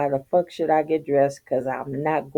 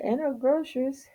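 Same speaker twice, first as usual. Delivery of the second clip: high disbelief; 10 semitones higher, 4 dB quieter than usual. Retold or imitated. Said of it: "I'm like".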